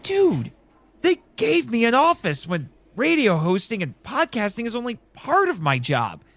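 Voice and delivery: flustered radio host to his friend, excited and high; overwhelmed excitement. Acted. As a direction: pitch jumps high, words tumbling out.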